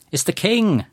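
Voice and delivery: put-on a child's high, thrilled call across the room; excited shout. A narrator's reading. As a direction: shout it out, spotting the king. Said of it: "Lenny shouted".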